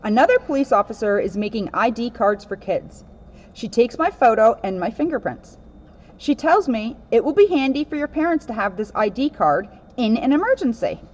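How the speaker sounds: lively read-aloud storybook voice; picture book reading, lightly animated; reading to children. Acted. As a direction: read it to a room of kids.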